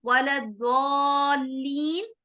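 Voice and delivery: वलद्दॉल्लीन slow, with a thick heavy d; heavy Arabic wa-lad-daalleen. Shown as a demonstration. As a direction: stretch वलद्दॉल्लीन with full tafkheem. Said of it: "सबसे last जो word है".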